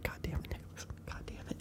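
hushed whisper under the breath; dismayed whisper. Retold or imitated. Classmates watch Nicholas get in trouble, dismayed whisper. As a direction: whisper it so the coach can't hear.

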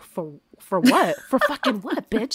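shocked and indignant retold outburst. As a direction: demand answers in shock. Shocked reaction to arrest news.

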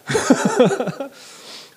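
high, loud chuckling burst; laugh. Real, not acted. Preacher congratulating a pop star, laughing. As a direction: laugh out loud at your own joke.

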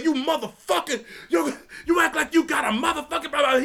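high, fast, angry yelling; furious coach. Retold or imitated. Coach cussing the kid out, high angry yell. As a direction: chew the kid out at full volume. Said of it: "he's like".

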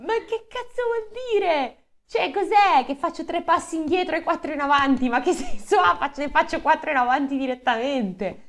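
high, loud indignant rant; high-pitched incredulous ranting; exasperated rant. Real, not acted. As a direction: rail against the nonsense. Ranting at plot logic.

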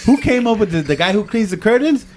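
high, giggling incredulous rant; giggling scientist scolding; laughing mock disbelief. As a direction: laugh through an outraged rant. Imagining Sagan scolding the team.

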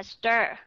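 "stir" drawn out with a full er; clear ER. Shown as a demonstration. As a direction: say "stir" slowly and distinctly. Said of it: "đọc rõ ràng là".

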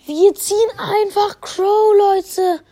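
hoarse after screaming, still hyped; raspy from yelling, excited. Real, not acted. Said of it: "ich bin grad so heiser geworden".

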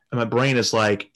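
ordinary talk

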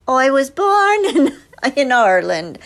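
loud, lifted stage Irish accent. Shown as a demonstration. Actress showing her learned stage accent, Irish accent. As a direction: demonstrate a broad Irish accent. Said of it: "learned how to do an Irish accent".

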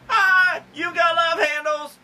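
high, loud taunting shout; mocking shout. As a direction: jeer at someone's belly. Mocking people's bellies.

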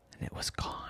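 whispered storyteller line; hushed narration. A narrator's reading. Parent telling a bedtime story, whispered. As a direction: breathe it out like a sad secret.

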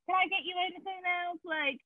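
high, soft customer-service Southern lilt; polite Southern accent. Retold or imitated. Serving bookstore and ice cream customers, in a polite Southern accent. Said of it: "I work in customer service".

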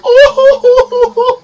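loud, high imitated wail; mock bawling. Imitating a relative bawling at a Christmas film, mock bawling. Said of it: "she just starts bawling loudly".

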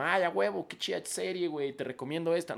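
chirpy, high fan voice; mock cheery commenter; friendly fan message. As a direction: read it like an excited fan. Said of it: "cuando es como".

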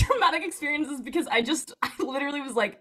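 usual speaking voice

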